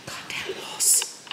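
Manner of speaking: hushed, breathy curse under the breath; whispered curse. Real, not acted. Woman whispering a curse at her horse, hushed. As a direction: mutter it unvoiced so the horse can't hear. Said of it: "whispered under breath".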